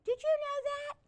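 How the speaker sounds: gasp, then a very high amazed question; gasping surprise. Real, not acted.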